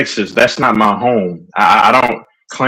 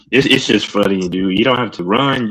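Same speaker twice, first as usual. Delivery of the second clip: ordinary talk, nothing marked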